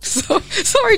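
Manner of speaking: high-pitched, giggly apology; laughing. Real, not acted. Co-host apologising as her friend's head drops, laughing. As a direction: apologise while cracking up. Cracks up mid-discussion.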